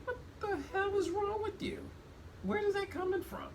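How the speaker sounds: retelling how he nearly screamed at his wife; mock exasperated outburst. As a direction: replay the near-scream in a squeaky voice. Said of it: "I was about to scream".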